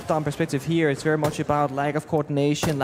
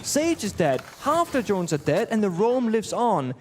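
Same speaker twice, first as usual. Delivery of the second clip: excited play-by-play; 5 semitones higher than usual. Acted. Play-by-play of the round.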